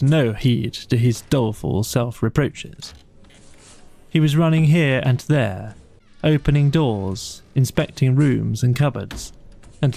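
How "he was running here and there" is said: usual speaking voice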